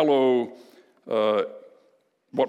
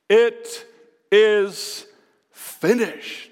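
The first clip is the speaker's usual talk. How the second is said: a somewhat louder, drawn-out triumphant declaration; triumphant cry; 3 semitones higher, much slower than usual. Retold or imitated. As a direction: proclaim it as a victory cry, not full force. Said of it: "he cried out in a loud voice".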